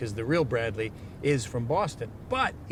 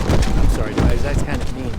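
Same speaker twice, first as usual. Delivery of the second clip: loud apology; 3 semitones lower, 11 dB louder than usual. Real, not acted. Loud outburst in car.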